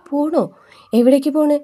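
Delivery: jolted into asking where she is going; mock alarmed father; startled question. Father stunned she is leaving.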